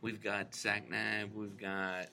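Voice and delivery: very soft, low, too-cool car pitchman; Matthew McConaughey. Acted. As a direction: murmur it low and laid-back like a movie star. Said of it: "So that is your Matthew McConaughey".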